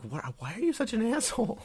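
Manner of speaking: high-pitched indignant question; mock exasperation. Acting an offended listener to a bad impressionist, mock exasperated. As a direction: ask it high, in put-on disbelief.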